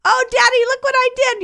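put-on very high, gleeful child's voice; a kid showing off his mess, delighted; proud little child. Child calling proudly to his father, very high and gleeful.

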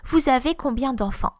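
"Vous avez combien d'enfants" starting up, falling on "d'enfants"; falling intonation. Shown as a demonstration. Teacher showing an open question, just falling intonation. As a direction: ask without effort, ending down. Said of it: "Just falling intonation".

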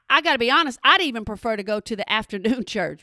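ordinary talk, nothing marked

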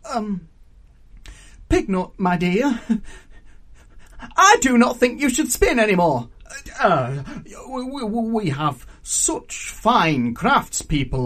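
loud, high, stammering panic; stunned, desperate prince. A narrator's reading. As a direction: scramble for reasons, tipping into nervous laughter. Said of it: "stunned and desperate".

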